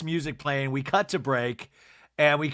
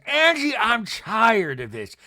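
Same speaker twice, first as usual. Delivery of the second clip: loud, slow mock accusation; theatrical put-on scolding; Meredith impression; 4 semitones higher, 6 dB louder, slower than usual. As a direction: hammer it out in a raised, drawn-out voice. Mimics Meredith accusing Angie.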